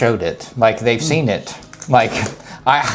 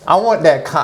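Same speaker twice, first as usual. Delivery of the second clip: usual speaking voice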